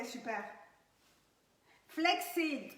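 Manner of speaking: normal talking voice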